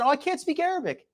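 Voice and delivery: high mock voice of the woman; mocking quote. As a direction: pitch it up, mocking her excuse. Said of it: "She said".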